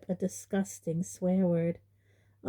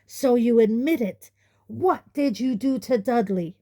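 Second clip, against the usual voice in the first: angry accusation; 5 semitones higher, 6 dB louder than usual. Acted. Uncle accuses Harry.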